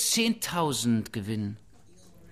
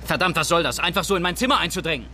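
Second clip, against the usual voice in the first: loud, higher-pitched anger; furious, raised voice at an intrusion; outraged protest; 5 semitones higher, 4 dB louder, faster than usual. Acted. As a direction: lash out at intruders in your room.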